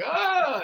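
high-pitched mock recoil yelp; sudden high "ah"; imitated startled cry. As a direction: cry out as if spat on. Acting out man backing away.

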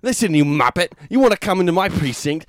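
high, loud sweary rant; angry boss. Pretend. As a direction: berate the rookie like a furious chef. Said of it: "Is the sergeant played by Gordon Ramsay?".

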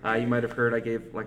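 normal talking voice

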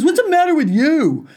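indignant man. Retold or imitated. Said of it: "He goes".

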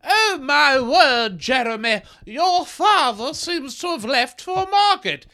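put-on high, slow, theatrical old-timey lady; prim period-drama voice. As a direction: overact a prudish costume drama.

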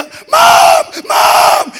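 very high, loud cry for mom; retold daughter screaming for her mother; child screaming. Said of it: "Y Claudia".

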